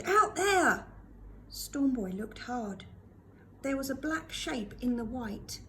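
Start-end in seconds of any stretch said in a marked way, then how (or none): 0.0-0.9 loud high call; yelled. A narrator's reading. Hideaway showing Stormboy the wreck, yelling. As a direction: point and shout over the wind. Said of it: "he yelled".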